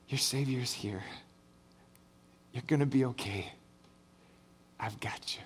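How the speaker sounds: very soft and low, sinking into a whisper; retold comforting whisper. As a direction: imitate a savior softly reassuring someone close. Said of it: "and whisper".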